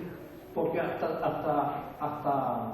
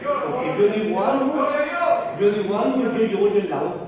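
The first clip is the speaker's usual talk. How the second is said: louder, higher insistent preaching; emphatic preaching; 5 semitones higher, 9 dB louder than usual. Acted. Pastor insisting to the church, loud. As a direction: press the point hard.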